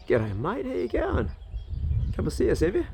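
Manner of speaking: lifted, friendly pet-talk greeting; talking to a bird. Real, not acted. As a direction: chat sweetly to the little bird.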